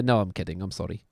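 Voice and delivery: ordinary delivery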